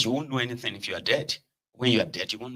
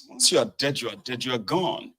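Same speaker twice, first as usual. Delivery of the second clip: ordinary talk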